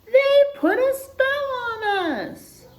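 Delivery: very high, loud, slow accusation; accusing animals. Acted. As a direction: declare the spell indignantly.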